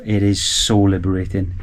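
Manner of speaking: ordinary delivery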